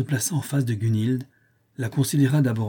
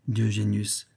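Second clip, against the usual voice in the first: ordinary delivery, nothing marked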